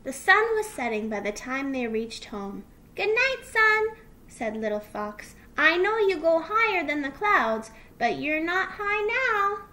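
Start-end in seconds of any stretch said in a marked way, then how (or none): 3.0-4.0 put-on high, loud childlike voice; small child's singsong farewell; little fox calling. Little fox calls to sun. 5.5-9.7 put-on high, loud childlike voice; singsong child, pitched up; little fox calling. Little fox calls to sun.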